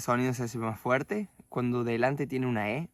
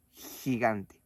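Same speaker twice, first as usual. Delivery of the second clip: normal talking voice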